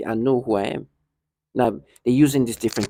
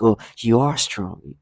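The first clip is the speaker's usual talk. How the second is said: slow, drawn-out mock condescension; mock condescending; slower than usual. They say condescending things, imitated slowly and condescendingly. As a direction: imitate someone talking down, stretching the words. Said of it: "however condescending you're saying".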